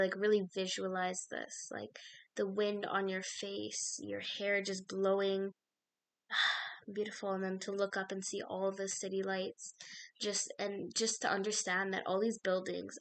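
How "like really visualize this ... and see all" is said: guided visualization. Acted. Guided visualization, soft and slow.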